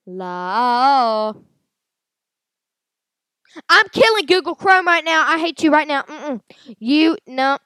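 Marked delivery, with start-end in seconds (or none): 3.7-7.6 angry shout. Real, not acted. Furious at browser ads.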